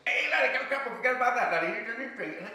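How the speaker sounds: fast-running, high Scottish warning shout; loud Scottish laddie-calling voice. Acted. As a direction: holler a warning in thick Scots. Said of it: "and a Scottish voice".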